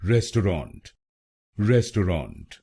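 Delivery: रेस्टोरेंट said clearly as res-tau-rant in three syllables; रेस्टोरेंट in three clear parts, res-tau-rant; three-syllable pronunciation. Shown as a demonstration. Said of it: "चलिए सीखते हैं पहला pronunciation".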